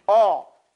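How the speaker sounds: "All" with oval lips; rounded all. Shown as a demonstration. Teacher modelling the itbaq shape, rounded "All". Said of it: "making it oblong".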